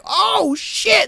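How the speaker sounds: high yelped surprise; a quick shocked squeak before bolting; startled donkey. Retold or imitated.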